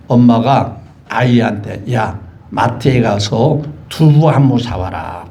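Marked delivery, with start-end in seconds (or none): 1.9-5.3 lower, slow bossy mom; mother's order. Retold or imitated. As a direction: lightly voice a mother sending her kid out. Mother voiced in example. (낮고 느린 엄마 말투)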